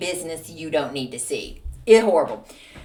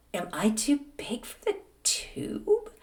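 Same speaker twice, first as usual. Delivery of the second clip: soft worried question; 4 semitones higher, 6 dB quieter, slower than usual. Retold or imitated. Worried inside tube, soft.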